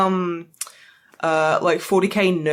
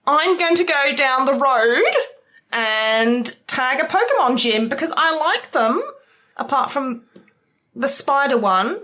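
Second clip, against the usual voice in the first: higher-pitched, put-on Australian accent; badly faked Aussie accent, rambling along; fake Australian accent; 6 semitones higher than usual. Shown as a demonstration. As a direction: ham up a peculiar Australian accent. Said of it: "When you try and put on an Australian accent, it's weird. It sounds fake".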